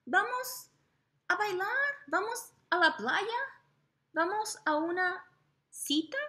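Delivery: put-on very high squealy girls' voice; screaming fangirls.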